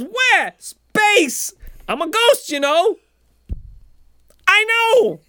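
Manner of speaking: high-pitched, loud cartoon exchange; hammed-up yelling up high; mock shouted dialogue. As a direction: holler back and forth like dim heroes. Acted shouted dialogue between space heroes.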